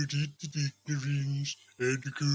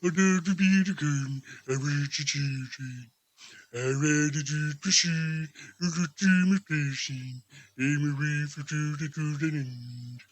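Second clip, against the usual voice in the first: ordinary delivery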